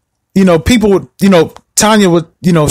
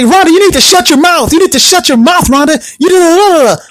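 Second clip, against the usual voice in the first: mock angry yelling; 9 semitones higher than usual. The family yelling at Rhonda to shut her mouth, mock angry. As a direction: bark it at her like the family used to. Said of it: "they would always get mad at her".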